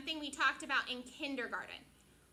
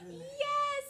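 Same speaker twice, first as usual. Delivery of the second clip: excited cheer; 12 semitones higher, 6 dB louder than usual. Real, not acted. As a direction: gasp then a high, loud yes. Big cheer at news.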